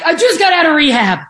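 hammed-up eager job seeker; overeager pleading. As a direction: beg for the job with too much enthusiasm. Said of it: "just being like".

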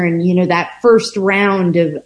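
ordinary delivery

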